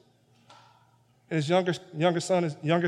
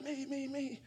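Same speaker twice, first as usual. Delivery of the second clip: much higher and much softer, eager me-me-me; eager volunteering; 12 semitones higher, 10 dB quieter than usual. Retold or imitated. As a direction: jump at the chance like a keen kid.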